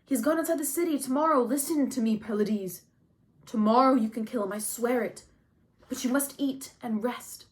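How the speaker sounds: loud, insistent urging to hold him back; urgent pleading. A narrator's reading. Odysseus restraining grieving Achilles, urgent and insistent. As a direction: press him urgently to listen and wait.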